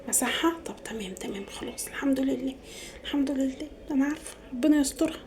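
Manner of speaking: whispered aside to herself; hushed relief. Acted. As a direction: mutter thanks under your breath, very softly. Anxious aside to herself in skit. (همس بارتياح بينها وبين نفسها)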